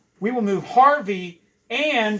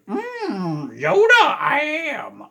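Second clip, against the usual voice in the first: high, slow, strained Yoda squeak; shaky high Yoda voice; Yoda impression; 9 semitones higher, much slower than usual. Shown as a demonstration. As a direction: creak it out like a little old Jedi. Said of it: "my Yoda impression isn't very good".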